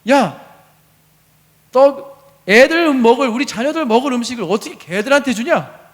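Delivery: loud, high, indignant acted retort; harsh rebuke. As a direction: scold her as if outraged. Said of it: "점점 세져요".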